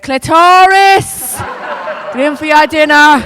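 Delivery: loud, high, slow shout from the window; mother calling out. Retold or imitated. Council estate mother shouting the kid in for dinner, loud and high. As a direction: bellow it down the estate like a mam.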